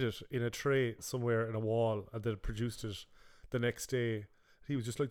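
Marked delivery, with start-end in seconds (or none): none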